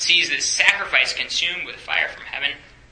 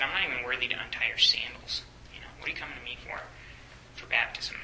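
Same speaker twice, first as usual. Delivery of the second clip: humble whisper; 8 dB quieter, faster than usual. Retold or imitated. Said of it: "he said".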